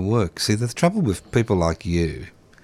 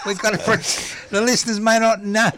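much higher, playful teasing; building it up; 13 semitones higher than usual. Real, not acted. Host teasing the guest on air, high and playful. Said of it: "I'm trying to build it up".

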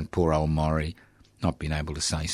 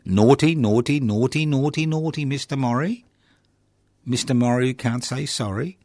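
higher, singsong naughty naughty, wagging a finger; mock scolding; 6 semitones higher than usual. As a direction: tease him like a naughty child.

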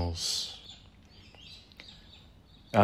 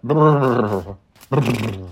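horse noises; 3 semitones higher, 8 dB louder, much slower than usual. Pretend. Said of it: "What noises do horses make".

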